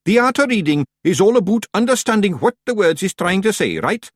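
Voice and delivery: high, loud Scots wee-man voice; high Scots pictsie. Acted. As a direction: lecture the clan like a cocky wee chief. Rob explains reading.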